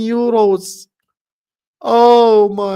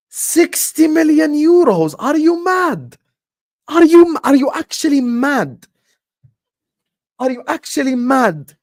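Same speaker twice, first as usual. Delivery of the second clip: higher, louder incredulous yelling; outraged shout; 7 semitones higher, 4 dB louder than usual. Real, not acted. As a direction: yell at the club as if they're mad. Furious at the sixty million price.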